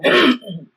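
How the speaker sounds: loud meaningful throat clear; a warning ahem; pointed throat clear. Acted. Traveler warning Bree to stop talking, pointed loud throat clear.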